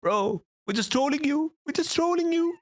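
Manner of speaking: high mocking singsong, teasing; mocking friend. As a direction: squeak it out like a gleeful troll. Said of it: "He's like".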